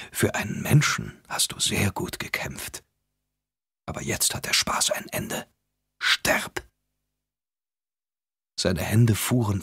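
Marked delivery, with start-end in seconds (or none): 0.1-6.6 soft, unvoiced menacing whisper. A narrator's reading. Shadow Rider whispering his death threat to Paul. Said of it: "sagte der Schattenreiter leise".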